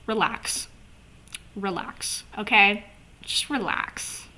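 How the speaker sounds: soft, slow, calming, trailing into a hush; mock soothing. Fan talking to Moxie, soft teasing calm-down. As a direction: talk him down gently, half teasing. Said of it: "I'm like, "Moxie".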